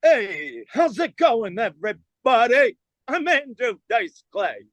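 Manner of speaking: hammed-up brash comic's stage greeting; Andrew Dice Clay. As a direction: impersonate a swaggering headliner, stretching the words. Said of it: "And I'm like".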